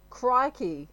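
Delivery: hammed-up Australian woman exclaiming; exasperated Australian woman. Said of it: "If she's an Australian woman".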